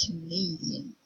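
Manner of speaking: Canadian with the stress on "na", said as "nay"; Canadian, weak "Ca" and strong "nay"; stress on second syllable. Shown as a demonstration. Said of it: "nay is the stressed syllable".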